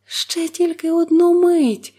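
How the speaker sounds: high, slow begging cry; wife begging for a moment; pleading. A narrator's reading. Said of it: "благально мовила дружина".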